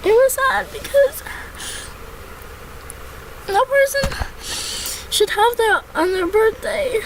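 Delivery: fighting back tears; crying. Real, not acted. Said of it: "everybody cries".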